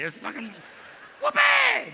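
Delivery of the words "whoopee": very high, loud whoop; gleeful whoop. Acted. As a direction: let out a mock joyful cheer. Acts out stoned happiness.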